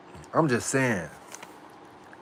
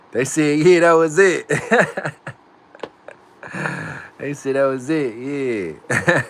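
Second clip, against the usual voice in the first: much higher and louder, laughing; thrilled; 5 semitones higher, 7 dB louder, slower than usual. Real, not acted. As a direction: burst out happy and laughing. Chat approves, he celebrates.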